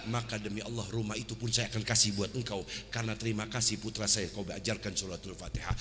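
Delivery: low, soft, gentle dignified voice; a humble noble man answering quietly; calm Imam Ali. Acted. Imam Ali answering the grateful teacher, low and calm.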